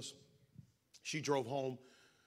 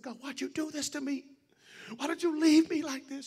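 high-pitched mock scream, building louder; retold screaming at God; 13 semitones higher, 7 dB louder than usual. Grieving mother to God in her backyard, retold angry scream. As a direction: imitate an angry cry, rising in force. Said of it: "I started screaming at him".

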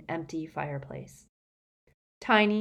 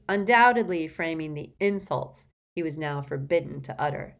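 ordinary delivery, nothing marked

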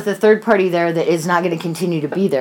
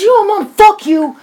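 high, louder, drawn-out angry retort; imitated yelling; 13 semitones higher, 5 dB louder, slower than usual. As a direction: act out a son yelling back at his mother. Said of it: "right now you're yelling".

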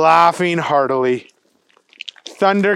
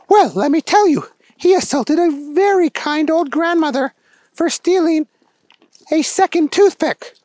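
very high woman official, emphatic; indignant head chief; 12 semitones higher, 4 dB louder than usual. Acted. Head chief telling what the agent did, indignant. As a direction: lay out the charge in a high voice.

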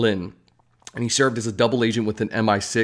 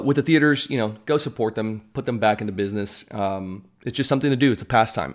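ordinary talk

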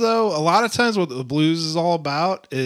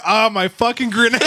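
put-on light drunk wail; 7 semitones higher, 3 dB louder than usual. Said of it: "only place you will ever hear".

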